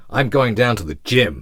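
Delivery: loud, confident American accent. Shown as a demonstration. Telling Dave about the gym in a loud American accent. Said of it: "I'm American all of a sudden".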